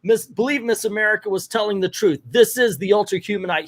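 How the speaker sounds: high, mock-heroic appeal to a crowd; imitated yelling.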